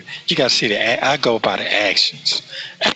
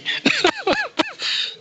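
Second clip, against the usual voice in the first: high laugh; 3 dB quieter than usual. Real, not acted. Laughing after his own remark.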